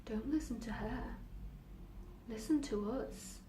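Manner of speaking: soft, intimate urging; hushed inner voice. Acted. Voices in her head turn the girl from doctor, hushed. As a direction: urge quietly, slowing at the end.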